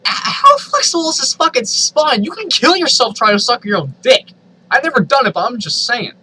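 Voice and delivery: high, loud disbelief; incredulous outburst. Real, not acted. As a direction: shriek in horrified amazement. Reader shocked at the story.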